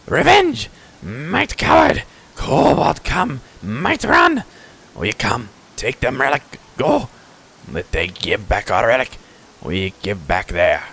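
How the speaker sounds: high, shouted bursts settling into slow growing demands; fierce kobold war talk, drawn out; vengeful kobold. Acted. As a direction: cry revenge in pidgin creature voice. Kobold chief recounts the attack.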